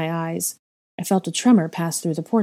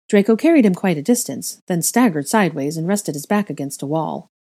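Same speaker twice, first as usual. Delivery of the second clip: ordinary delivery, nothing marked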